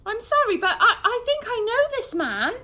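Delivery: high, loud, nervous laughing disbelief; stunned, half laughing; shocked recognition. A narrator's reading. Mum recognising Murden, high and loud with nervous laughter. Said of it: "shocked mum, laughing nervously".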